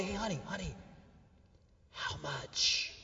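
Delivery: hushed imitation of a whispering wife; quiet whisper. Wife asking husband on her way to the vet, imitated whisper. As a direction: drop to a furtive whisper. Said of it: "but she whispers quietly".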